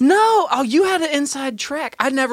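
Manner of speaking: high startled no; shocked yelp of denial; surprised. Real, not acted. Shocked reply.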